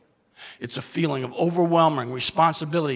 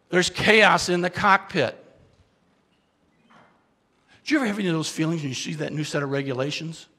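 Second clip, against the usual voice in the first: normal talking voice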